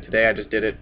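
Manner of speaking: normal talking voice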